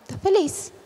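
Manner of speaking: soft, unconvincing claim of happiness; unenthused happiness. Shown as a demonstration. Teacher showing a lack of congruence, unenthused. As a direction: say it as if you don't mean it. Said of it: "Eu tô passando isso que eu tô feliz?".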